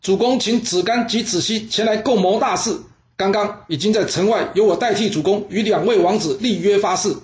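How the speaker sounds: high, carrying call to the whole room; loud announcement. Acted. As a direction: declare it loudly so everyone hears. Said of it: "他突然间大声喊道".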